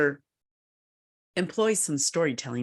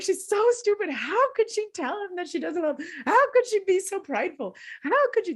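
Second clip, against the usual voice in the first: put-on high, indignant childish ranting; hammed-up outrage of a twelve-year-old over a novel; mock tearful rant. Twelve-year-old ranting to mom about Scarlett, high mock outrage. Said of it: "I'm really crying, and I'm angry".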